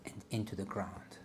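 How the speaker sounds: calm, soft meditation guide voice; guided meditation. Acted. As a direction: ease them into a body scan. Leads a grounding meditation.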